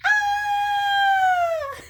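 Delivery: very high but held-back scream; inside-voice scream. Shown as a demonstration. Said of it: "I like to do inside voices".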